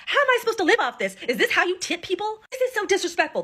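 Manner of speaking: high, indignant imitation of a drunk waitress yelling; mock drunk rant. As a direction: retell her outrage over the tip, not full voice. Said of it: "She starts yelling and cussing at me".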